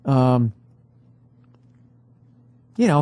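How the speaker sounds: ordinary delivery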